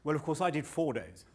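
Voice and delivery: mock boastful one-upping bloke. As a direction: outdo the last man, laughing. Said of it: "pipes up and he goes".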